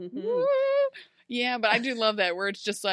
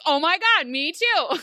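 loud, high, thrilled "oh my God"; excited; 7 semitones higher, 7 dB louder than usual. Retold or imitated. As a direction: burst out in delight at the match. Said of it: "And Emma goes".

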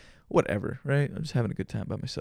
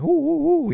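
startled ooh; 7 dB louder, slower than usual. Retold or imitated. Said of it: "I was like".

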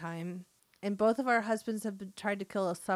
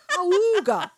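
loud honking awooga; ahooga horn; 9 semitones higher, 8 dB louder than usual. Pretend. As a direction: blare it like an old car horn. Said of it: "we need, like, a horn".

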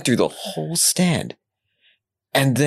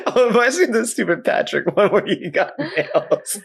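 high voice broken by laughs; helplessly amused, laughing delivery; talking through laughter; 6 semitones higher than usual. Real, not acted. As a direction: ask while still cracking up. Still laughing about meme pictures.

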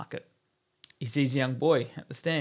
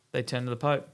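ordinary delivery

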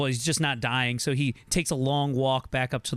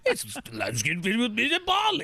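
put-on Scottish accent; 6 semitones higher than usual. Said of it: "In the best Scottish accent".

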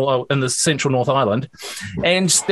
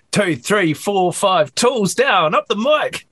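rhythmic count-in climbing steadily higher; 7 semitones higher, slower than usual. Shown as a demonstration. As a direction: count the band in, pitch rising to a high finish. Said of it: "a new counting".

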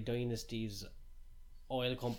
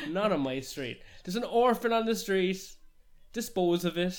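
higher, louder indignant toff voice breaking into a laugh; mock outraged snob; 7 semitones higher, 9 dB louder than usual. Snobby resident ordering the orphan removed, hammed-up outrage. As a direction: bark out orders like a cartoon snob, cracking up.

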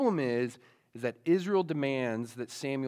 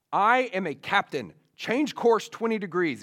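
louder, higher insistent command; captain pulling rank; 5 semitones higher, 6 dB louder than usual. Retold or imitated. Captain ordering the other ship to move, imperious. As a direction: assert authority over the other ship.

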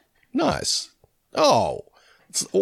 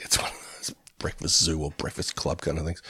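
whisper; 9 semitones lower, 10 dB quieter than usual. Real, not acted.

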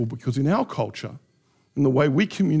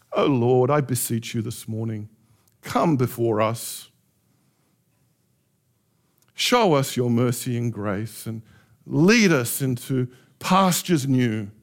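lower, slow churchy prayer; mock eloquent prayer, lower and slow; formal prayer voice; 4 semitones lower, slower than usual. Shown as a demonstration. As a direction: pray in stiff old-fashioned pomp. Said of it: "Is that how you talk".

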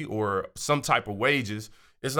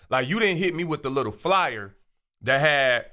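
normal talking voice

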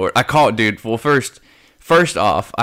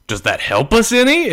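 mock sarcastic; 12 semitones higher than usual. Retold sarcastic reply.

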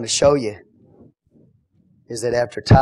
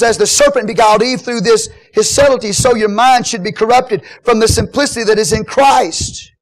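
high, loud declaimed scripture; preach; 10 semitones higher, 9 dB louder than usual. Acted. Paul warning the church of false ones, full-force preaching.

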